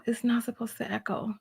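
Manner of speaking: whispered, exasperated; frustrated whisper. Real, not acted. Fixing the stream audio, a frustrated whisper.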